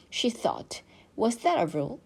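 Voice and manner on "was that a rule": usual speaking voice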